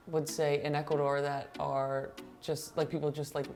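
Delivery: usual speaking voice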